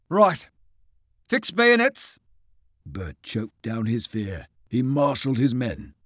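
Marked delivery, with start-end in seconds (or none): 0.1-2.2 calm officer order. Acted.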